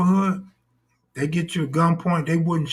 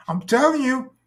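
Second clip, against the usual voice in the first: even higher, insistent plea; higher pleading voice; 8 semitones higher than usual. Retold or imitated. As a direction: insist in a high put-on voice. Acting drunk woman pleading.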